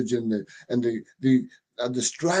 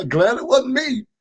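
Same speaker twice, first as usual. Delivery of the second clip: mock relief; 10 semitones higher, 4 dB louder than usual. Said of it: "they're gonna say".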